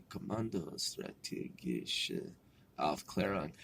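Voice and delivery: very soft, low, slow practice to oneself; working on German. Real, not acted. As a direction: sound out each German word slowly under your breath. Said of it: "I gotta work on my fucking German".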